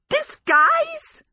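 high, loud and incredulous; yelled disbelief. A narrator's reading. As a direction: yell the word back at him. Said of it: "Sophie yelled".

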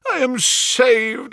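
high, slow, ecstatic cry; shriek of joy. Acted. Banker clutching his recovered gems, high ecstatic cry. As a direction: let the relief burst out. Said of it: "With a shriek of joy".

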